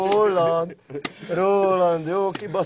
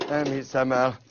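usual speaking voice

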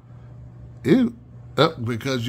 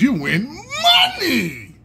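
high, laughing shout on money; excited; 9 semitones higher, slower than usual. Real, not acted. Telling viewers they can win money, excited laughing shout. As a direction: shout it like a game show host.

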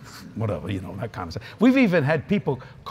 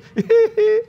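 high-pitched giggle, loud bursts; imitating Keith's laugh; 7 dB louder than usual. Keith reacts to the meal bill, high imitated giggle. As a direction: squeal out a mimicked giggle. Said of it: "And Keith goes".

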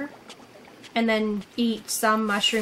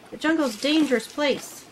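ordinary talk, nothing marked

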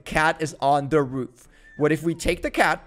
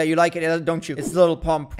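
usual speaking voice

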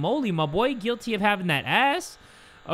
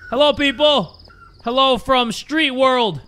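loud, higher hello shouted to the balloon; calling out; 6 semitones higher, 5 dB louder, slower than usual. Real, not acted. Gamer calling out loudly to people in the balloon. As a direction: hail people far off in the sky.

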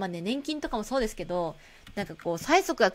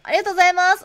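higher, louder clerk thank-you; bright shop-counter thanks; cheerful cashier thank-you; 8 semitones higher, 10 dB louder, much faster than usual. Acted.

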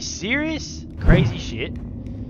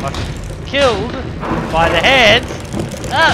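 loud, higher frustrated yell; loud outraged cry; angry shout; 7 semitones higher, 10 dB louder than usual. Real, not acted. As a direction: yell it as you die. Dying to the hands.

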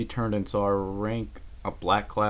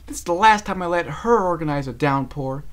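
louder, higher fed up; frustrated; 9 semitones higher, 8 dB louder than usual. Acted. Rainbow Dash fumes about the bad downpour. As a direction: vow never to trust her again.

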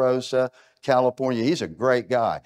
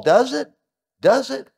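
incredulous challenge; 10 semitones higher, 5 dB louder than usual. Real, not acted. Doubting a claim just quoted.

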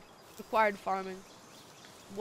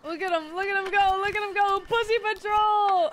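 excited cooing; 9 semitones higher, 7 dB louder than usual. Real, not acted. Player cheers on cute creatures, excited cooing. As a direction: cheer them on, louder each time.

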